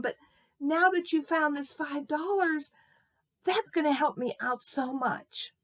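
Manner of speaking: high-pitched, acted weepy voice; tearful old lady. Old lady thanks boy over five dollars, acted tearful. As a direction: explain hardship, moved almost to tears. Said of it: "She started to cry".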